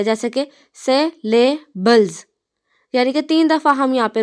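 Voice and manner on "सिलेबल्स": सिलेबल्स in three slow beats with clear pauses; broken into syllables. Shown as a demonstration. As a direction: say सिलेबल्स syllable by syllable, stopping twice. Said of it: "तीन दफ़ा रुकते हैं".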